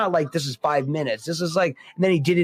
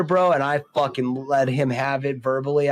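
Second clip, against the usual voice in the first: normal talking voice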